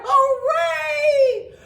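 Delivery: loud high hooray; cheering. Acted. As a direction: cheer for the hero. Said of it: "Everyone cheered".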